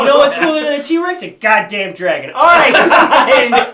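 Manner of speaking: loud, high and laughing; laughing excitedly. Real, not acted. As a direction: crack up while shouting it.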